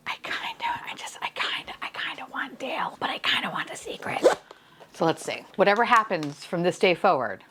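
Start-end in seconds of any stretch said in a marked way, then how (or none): 0.1-4.2 secret whisper. Real, not acted. Confessing which figure she wants.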